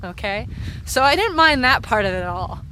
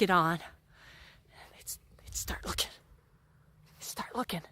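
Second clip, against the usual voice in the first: hushed, creeping whisper; 3 semitones lower, 10 dB quieter than usual. Retold or imitated. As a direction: whisper like sneaking into a tent. Kids creeping into the tent.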